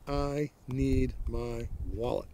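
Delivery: slow, drawn-out, deliberate demand; slow insistence. Retold or imitated. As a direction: spell it out slowly as a firm demand. Insisting to the officer on phone.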